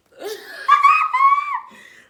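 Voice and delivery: a high, loud shriek of a laugh; loud burst of laughter. Real, not acted. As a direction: crack up out loud.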